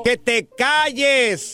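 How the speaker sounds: high, drawn-out exasperated shout; mock angry shushing. Prank manager to crying drunk, mock angry shout. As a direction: stretch the scolding out slowly.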